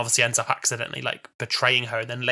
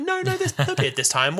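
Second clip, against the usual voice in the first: high, light quoted Doctor; mock Doctor voice; 13 semitones higher than usual. Doctor dismissing the Master's beard, high mock voice. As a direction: squeak it out like the Doctor brushing it off. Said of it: "He's like".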